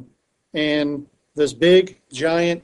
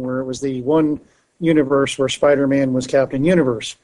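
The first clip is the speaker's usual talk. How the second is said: ordinary talk, nothing marked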